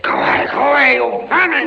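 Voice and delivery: high, loud yell at the rats; shooing shout. Acted. Prisoner yelling at rats near the plate, loud shout.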